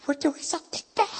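high-pitched gleeful sneaking voice; giddy mischief. Acted. Acting out friends sneaking out at night, giddy and high. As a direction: squeal it like a naughty secret.

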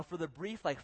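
ordinary talk, nothing marked

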